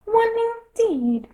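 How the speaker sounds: very high, slow, huffy squeak; indignant Dormouse. A narrator's reading. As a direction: sniff it out, deeply offended. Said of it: "said the Dormouse indignantly".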